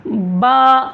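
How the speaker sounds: बा held long on an open aa; open-mouthed drawn-out baa. Shown as a demonstration. Teacher has students listen first, long open बा. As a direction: open the mouth fully and sustain बा. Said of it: "पहले अच्छे से सुनिए".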